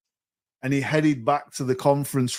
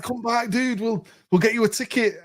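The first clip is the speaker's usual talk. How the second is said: high imploring call after him, pitched way up; pleading call; 10 semitones higher than usual. Retold or imitated. To the retiring salesman at the door, a high pleading call.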